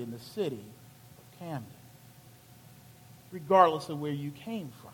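level with him gently; hushed hard truth; quiet sober warning. Retold or imitated. Friend's sober lesson.

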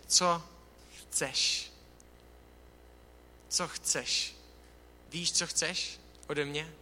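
hushed, gentle, unvoiced question; soft gentle question. Jesus asks the blind beggar softly, hushed and gentle. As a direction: ask it like a tender whisper.

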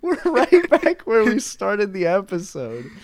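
high, slow laughing complaint; laughing exasperation. Real, not acted. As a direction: laugh it out in disbelief.

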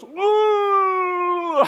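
high, loud drawn-out ooh; a long high yelp as he's dunked; high gurgling yelp. Retold or imitated. Rafter being pushed underwater, high drawn-out yelp. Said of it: "and he goes".

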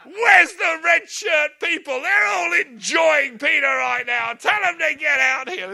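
slow, high, loud shouting; mock shouted complaint. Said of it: "somebody burst in here and say".

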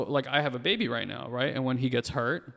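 normal talking voice

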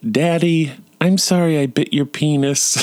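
put-on small child's high voice, not actually hushed; son's quiet apology. Son apologising to daddy, high child voice. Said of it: "He said really quietly".

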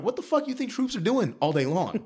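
high-pitched, incredulous comeback; indignant retort. Retold or imitated. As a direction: throw it back, voice jumping up high in disbelief.

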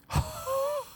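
sharp minty exhale; high icy sigh. Acted. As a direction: breathe out a cold hiss.

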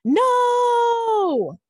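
high, loud drawn-out cry of no; reenacted startled scream, stretched out; retold scream. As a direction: wail a long, loud NO at a very high pitch. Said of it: "screamed at the top of my lungs".

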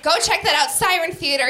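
ordinary talk, nothing marked

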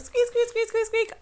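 squeaky noise. Acted. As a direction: squeak it out like rusty brakes.

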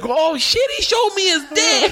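high mock girl squeal; hammed-up high girl voice; excited mock girl. Imitating girl on mic.

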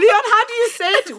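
ordinary talk, nothing marked

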